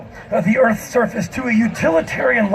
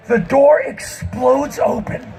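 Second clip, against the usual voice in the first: ordinary talk, nothing marked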